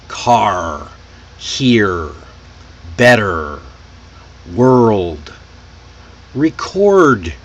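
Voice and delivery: "Car, here, better, world, Record" spaced out, each R strong; strong American R. Shown as a demonstration. Teacher leading a repeat drill, strong R in "Car, here, better, world, Record". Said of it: "repeat the strong R sounds".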